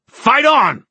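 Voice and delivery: high furious battle shout. Acted. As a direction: bellow it at the cowards. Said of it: "Sebastian shouted".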